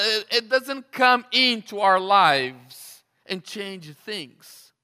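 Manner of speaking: loud, driving pulpit delivery; forceful preacher working up the crowd; fired-up preaching. Real, not acted. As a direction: build the argument with loud insistence.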